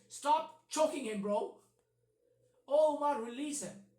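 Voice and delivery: loud, pressing mock order to stop; hammed-up urgent "O Umar, release him"; urgent command. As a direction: bark it like you're breaking up a fight. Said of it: "So his first reaction is".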